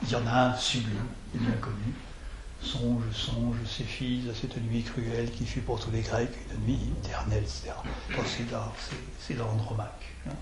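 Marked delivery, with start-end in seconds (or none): 2.6-7.4 a soft line of verse opening on a stressed "Songe"; quiet Racine recital. Shown as a demonstration. Teacher quotes Andromaque softly to show a line that opens on an accent. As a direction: start on "Songe" with a firm accent, then carry the line. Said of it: "Andromaque disant songe".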